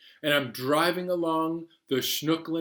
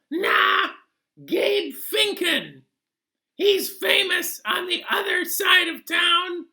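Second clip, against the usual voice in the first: odd passenger voice; 6 dB louder than usual. Acted. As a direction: squeak it out like a goofy know-it-all. Taxi passenger character voice.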